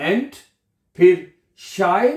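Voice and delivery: Ent then Schei said as separate broken syllables. Shown as a demonstration. Teacher breaking the word into syllables for pronunciation. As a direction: split Entscheidung into Ent, Schei. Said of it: "तोड़ के पढ़ लिया करिए".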